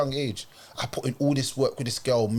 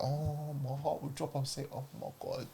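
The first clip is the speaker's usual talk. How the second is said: much softer, near whispered inner thought; hushed aside; 9 dB quieter than usual. Retold or imitated. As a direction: say it to yourself under your breath. Inner reaction aside during phone call.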